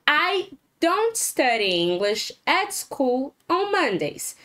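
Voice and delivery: slow, deliberate example sentence; slow model sentence. Shown as a demonstration. Teacher reading example for class to repeat, slow. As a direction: read it slowly so students can copy.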